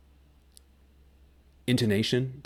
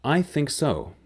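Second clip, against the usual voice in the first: I think so, quick and falling, stress on I, confident; sure I think so; 8 semitones higher, slower than usual. Shown as a demonstration. Teacher models a sure I think so, quick and falling. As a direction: punch I and drop so at the end, certain. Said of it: "What is the difference?".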